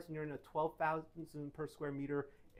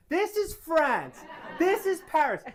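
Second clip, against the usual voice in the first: slow, loud and higher, spelling it out to a client; emphatic pointing out; 8 semitones higher, 7 dB louder, slower than usual. Retold or imitated. To a confused client holding up a map, slow and emphatic. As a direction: drag each word out like a teacher at the map.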